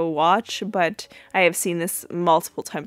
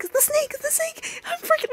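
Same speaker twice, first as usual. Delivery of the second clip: imitated panic in a thin, sky-high voice; freaking out. Retelling Claire spotting the snake, freaking out. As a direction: squeal it very high, in a mock panic. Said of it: "she's, like, freaking out".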